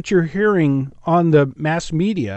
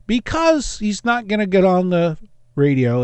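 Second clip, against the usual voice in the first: ordinary talk, nothing marked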